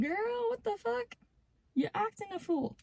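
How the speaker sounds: gentle high-pitched reproach; mock scolding. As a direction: chide a friend in a high, hushed pitch. Mock scolding of imagined anxious partner.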